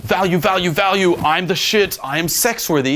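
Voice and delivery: high-pitched, insistent hype chant; mock a guy selling himself too hard; over-the-top hype. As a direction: pile it on at a high pitch, relentlessly. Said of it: "slow things down from the whole fun".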